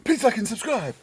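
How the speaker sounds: high, loud hammed-up youtuber voice; mock youtuber sign-off. Vlogger mocking youtuber outros to viewers, high and hammy. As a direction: ham up the like-and-subscribe plea.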